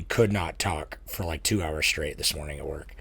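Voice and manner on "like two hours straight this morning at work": hoarse, rough-edged voice; a bit raspy from losing it. Real, not acted. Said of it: "raspy voice a lot of the time".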